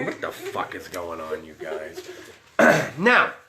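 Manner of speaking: ordinary delivery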